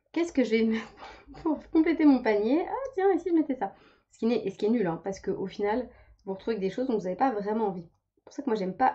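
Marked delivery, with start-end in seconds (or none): 0.1-3.7 high, breezy impulse buying voice; impulse shopper. Retold or imitated. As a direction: mimic her own careless shopping. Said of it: "C'est vraiment en mode".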